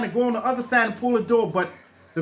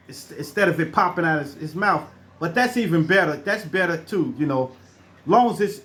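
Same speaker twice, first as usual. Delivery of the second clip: usual speaking voice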